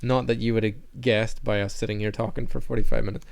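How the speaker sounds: ordinary talk, nothing marked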